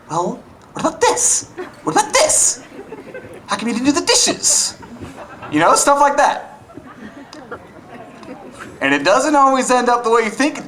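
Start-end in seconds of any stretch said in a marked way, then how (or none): 0.1-4.8 high, squeaky mock nagging; a petty jab, hammed up high. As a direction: nag in a silly high voice. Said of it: "you just keep going".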